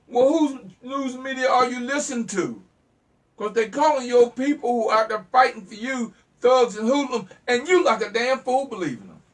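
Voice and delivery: high, loud scolding of the audience; indignant loud preaching; angry rant. Real, not acted. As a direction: lay into them, loud and fed up. Heated rant at own listeners.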